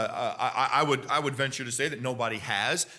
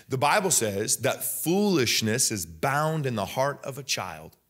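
ordinary talk

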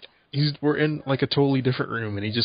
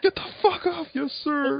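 much higher, cartoonish yell then squeaky reply; mock boss shout; 13 semitones higher than usual. Player imitating a boss reviving his crew, high mock shout. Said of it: "It's like".

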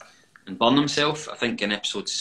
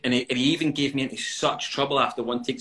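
ordinary talk, nothing marked

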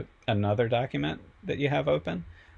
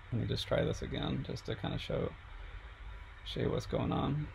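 soft, half-whispered mutter to herself; muttered aside; 10 dB quieter than usual. Real, not acted. Tutor stuck on a glitchy computer, softly muttering to herself. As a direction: mumble it under your breath while fixing the glitch.

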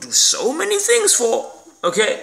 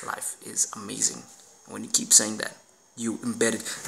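much lower, much softer, nearly hushed; 10 semitones lower, 10 dB quieter than usual. Real, not acted. Telling viewers life is amazing, in a low hush. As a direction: draw them in with a low murmur.

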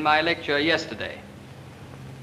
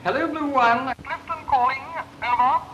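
crisp, formal radio call, pitched higher; radio procedure call; 8 semitones higher than usual. Shown as a demonstration. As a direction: call up the station by the book over the RT. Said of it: "call me up in turn and make contact with me in the way I outlined".

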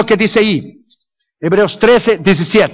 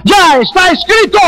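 preaching shout; 8 semitones higher than usual. Real, not acted. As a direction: proclaim it at full force. Preacher building to a cry.

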